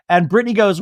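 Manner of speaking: ordinary talk, nothing marked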